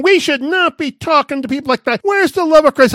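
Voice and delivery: high, loud scandalised scolding; rebuking like a shocked church member; offended. Retold or imitated. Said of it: "did he take offense".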